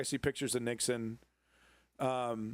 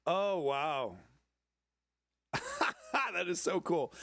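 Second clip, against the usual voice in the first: delighted amazement; 4 semitones higher, slower than usual. Real, not acted. Delighted at museum exhibit.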